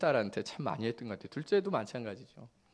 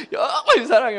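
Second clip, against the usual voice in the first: much higher, louder, overdone loving words; hammed-up sweet talk; 13 semitones higher, 15 dB louder than usual. Father teasing his daughter into tears, hammy and much higher. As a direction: lay it on thick on purpose. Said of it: "가식적인 말을 하는 거죠".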